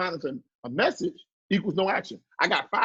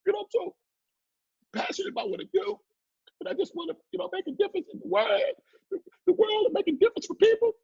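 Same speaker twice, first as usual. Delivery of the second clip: high, teary, half-whispered mock sob; hammed-up tearful plea, much higher and breathy; mock crying; 11 semitones higher than usual. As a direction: imitate a weepy entrepreneur near tears, pitched much higher. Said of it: "after they finish crying".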